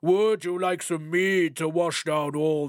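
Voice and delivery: courting giant, eager to please; a lightly voiced giant king; wooing giant king. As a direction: offer the drink sweetly. Thrym courts his bride.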